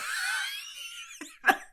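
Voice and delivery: very high squeal of laughter. Real, not acted. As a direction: dissolve into a thin, squeaky laugh. Breaks up while signing off.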